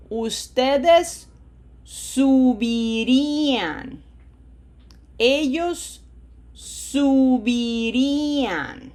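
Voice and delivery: loud, very slow "subiría" forms, every syllable drawn out; chant-like model of "subiría", long vowels, stress held on the í; syllable drill. Shown as a demonstration. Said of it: "Be reminded of the syllables".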